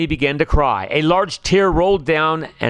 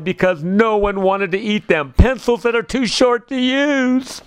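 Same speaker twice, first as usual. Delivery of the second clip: weepy owl voice going higher and higher; crying, rising; 6 semitones higher than usual. A narrator's reading. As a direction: pitch climbs higher into a tearful wail. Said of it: "he cried".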